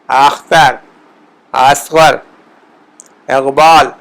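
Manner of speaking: اسم اغلب اختر اصغر اقبال with crisp Persian hamza vowels; word drill, one by one; Persian example words. Shown as a demonstration. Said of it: "مثال ہم دیکھتے ہیں".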